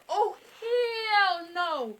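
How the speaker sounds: high, loud, drawn-out refusal; hammed-up scandalized reaction; appalled disbelief. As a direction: stretch it out in mock horror. Reacting to couple leaving bedroom.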